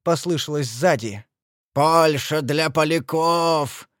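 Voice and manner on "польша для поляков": slow, stretched-out bellow; drawn-out chanting roar; roared slogan. A narrator's reading. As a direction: roar it like a battle cry. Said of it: "проревел Великий Гонзо".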